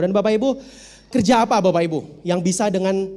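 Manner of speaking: ordinary talk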